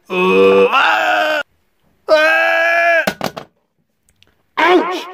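high loud cries of pain; pained yells. Acted. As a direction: scream as if hit hard. Character gets hurt.

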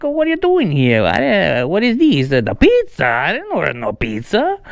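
hammed-up Italian accent with big pitch swings. Shown as a demonstration. Prankster rehearsing for the pizza delivery, mock Italian accent. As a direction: play an indignant Italian who ordered nothing. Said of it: "uh, an Italian accent".

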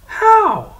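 high, loud, keen how; young prince asking how, high and eager; eager question. Acted. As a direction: jump at the offer. Francis asks eagerly.

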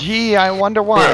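loud, high sarcastic quip; mock wonder, dripping sarcasm. Real, not acted. Mocking the obvious suspicion.

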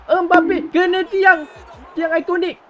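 high, loud relieved yelling; excited shout. Real, not acted. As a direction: shout in disbelief at the post. Opponent hits the post.

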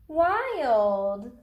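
ordinary delivery

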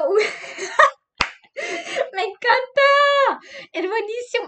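high laughing squeal of delight; laughing shout. Real, not acted. (grito entre risas)

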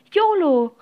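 loud, high joyful cry; happy exclamation, falling from high; delighted call. A narrator's reading. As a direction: call out to a long-lost friend. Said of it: "rief Leo erfreut".

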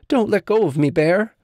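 high, anxious little tiger; high-pitched, mildly frightened appeal; worried cry. A narrator's reading. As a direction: plead in a high storybook voice. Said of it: "cried Little Tiger".

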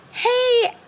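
chirpy high hey; bright greeting. Shown as a demonstration. Consultant greeting friend Tracy, chirpy and high. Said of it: "So what if you said".

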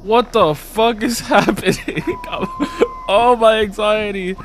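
high, rattled cry with a laugh breaking in; anxious outburst. Real, not acted. Rattled player reacting to a scare, anxious high outburst. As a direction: cry out high from frayed nerves. Scare, names own anxiety.

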